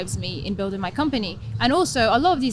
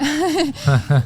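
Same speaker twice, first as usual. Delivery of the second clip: bright burst of laughter, higher and louder; 5 semitones higher, 6 dB louder than usual. Real, not acted. Guest laughing at the host's teasing aside, a real laugh. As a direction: laugh out loud at the joke.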